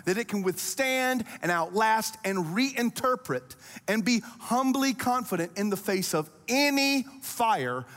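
high, impassioned preaching that keeps climbing; fervent pulpit voice as Jesus, rising to a peak; full-force preaching. Acted. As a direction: speak Jesus' promises over the crowd, building each take heart.

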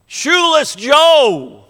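put-on a boy's high, slow call to his hero; child crying out. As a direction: call out his name like a hopeful little boy. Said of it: "you hear a little tiny voice cry out".

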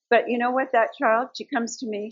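normal talking voice

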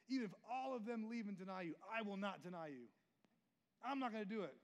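loud, rising fervent pledge; excited vow. Retold or imitated. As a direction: swear loyalty at full volume. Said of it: "so excited to stand up and be like".

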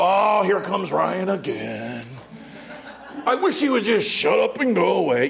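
mock annoyed God. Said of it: "God doesn't just go".

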